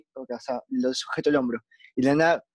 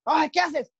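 retold startled janitor; 10 semitones higher, slower than usual. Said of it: "el de limpieza".

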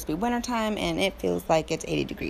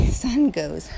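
normal talking voice

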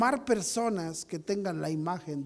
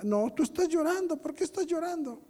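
put-on higher-pitched child questioning his mother; lightly voiced boy, insistent and higher; Marquín; 7 semitones higher than usual. Son pressing his crying mother, lightly imitated child. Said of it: "oigo al Marquín que le dice".

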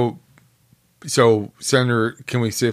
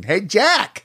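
high Boston woman impression; nasal-free Boston accent, high pitched; 10 semitones higher than usual. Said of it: "Boston accent impression".

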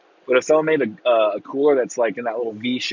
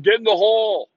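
higher, louder imitation of a golf crowd; mock golf fan yell; 8 semitones higher, 4 dB louder than usual. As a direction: lightly mimic a spectator yelling at the ball. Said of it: "dudes yelling".

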